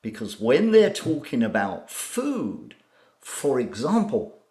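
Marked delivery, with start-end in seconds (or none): none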